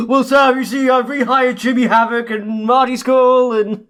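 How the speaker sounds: hammed-up official voice, slightly theatrical; mock booker announcement. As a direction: play the clueless boss proudly announcing. Mock booker announcing bad rehires.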